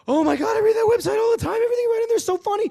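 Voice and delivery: very high, loud fangirl squeal; gushing fan. Retold or imitated. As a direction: squeal it like a starstruck superfan. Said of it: "when he goes".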